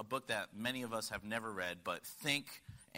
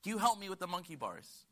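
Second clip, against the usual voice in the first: put-on little girl asking for help; 7 semitones higher, faster than usual. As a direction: plead like a small girl asking daddy. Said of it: "And so she goes".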